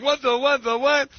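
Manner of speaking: high, stunned "what the"; mock shock. Host retelling seeing the IRS head, mock stunned. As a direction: sputter in fake disbelief. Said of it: "I'm like".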